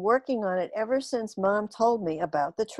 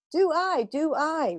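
eager aliens; 8 semitones higher than usual. A narrator's reading. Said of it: "replied everyone".

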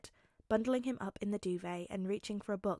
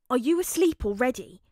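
loud; 2 semitones higher, 8 dB louder than usual. Acted. Loud question.

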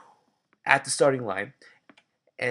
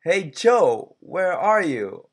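called out higher and louder, slow; calling out; 7 semitones higher, 10 dB louder, slower than usual. Acted. Bob calling loudly to find his friend. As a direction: shout across to find Joe. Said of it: "Bob asked".

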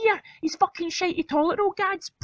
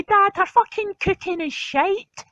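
usual speaking voice

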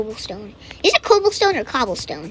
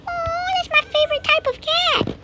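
delighted squeal. Real, not acted. Delighted at the cat.

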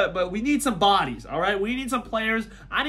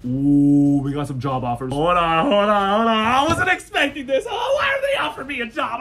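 shocked shout; 6 dB louder than usual. Real, not acted. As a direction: cry out like you can't believe it. Shocked by surprise job offers.

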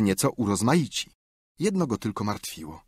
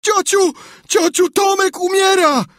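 very high, loud panicked scream; 9 dB louder than usual. A narrator's reading. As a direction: yell downstairs for help in terror. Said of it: "wrzeszczał".

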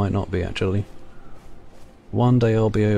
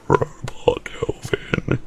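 robot voice; 5 semitones higher, much slower than usual. Shown as a demonstration. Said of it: "It doesn't work".

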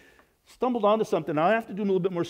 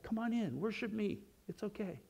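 higher, much softer, coaxing invitation; tender God; 5 semitones higher, 11 dB quieter than usual. Pretend. God inviting the forgiven believer to worship, high and hushed. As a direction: lift the voice and hush it, welcoming.

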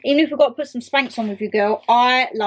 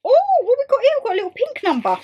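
excited; 10 semitones higher, faster than usual. Real, not acted. Opening another parcel on camera, eager and high. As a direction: pitch it up high with delight.

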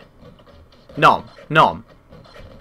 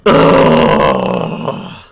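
defeated groan; 4 dB louder than usual. Real, not acted. Dino dies.

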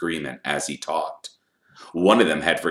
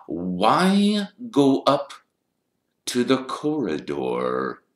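slow, drawn-out question falling in pitch; probing Snape; 8 semitones higher, 5 dB louder, slower than usual. A narrator's reading. Snape cornering Harry about the corridor, slow and probing. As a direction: press slowly, lingering on each word.

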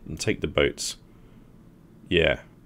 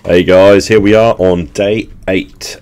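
robot voice; 2 semitones higher, 12 dB louder, slower than usual. Real, not acted. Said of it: "I still sound like a robot".

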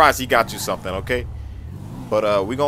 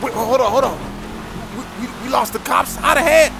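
dad amazed son lost the cops, astonished; 12 semitones higher than usual. Acted.

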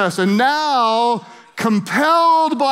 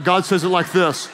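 ordinary talk, nothing marked

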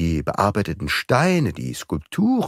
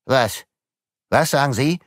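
startled question, a bit higher; the magistrate hears it is fake, calls out startled; called out; 6 semitones higher than usual. A narrator's reading. Alarmed call. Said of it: "rief Filleul".

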